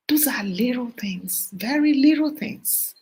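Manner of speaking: ordinary talk, nothing marked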